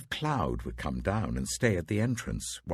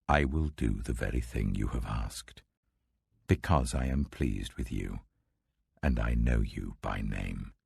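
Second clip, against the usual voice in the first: lower, grave voice of God; voice of the Lord; 8 semitones lower than usual. Acted. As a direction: speak as the Lord, weighty and solemn.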